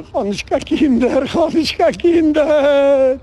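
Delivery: high, drawn-out imitation wail; hammed-up tearful plea; mock weeping. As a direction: wail it like a weeping petitioner, stretching the last word. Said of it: "sie kommen weinen".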